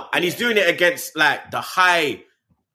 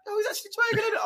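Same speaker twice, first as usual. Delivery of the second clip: much higher, soft mock-amazed whisper-like aside; girlfriend's amazed aside; 7 dB quieter, much faster than usual. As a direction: whisper it in disbelief to your partner. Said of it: "she gave me the eyes".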